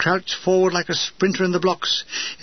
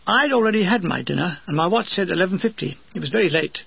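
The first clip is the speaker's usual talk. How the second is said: usual speaking voice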